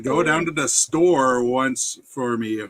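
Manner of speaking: slow, careful repeat of a Yooper saying; slow and deliberate; slowed for clarity. Shown as a demonstration. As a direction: spell it out slowly so it's understood. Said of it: "I got it the second time".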